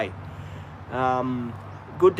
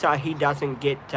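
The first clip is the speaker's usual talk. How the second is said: usual speaking voice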